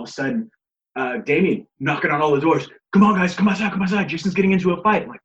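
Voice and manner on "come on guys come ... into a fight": imitating a high, raised-voice summons; rally everyone outside, as retold; urgent calling out. Acted alarm, knocking on all doors.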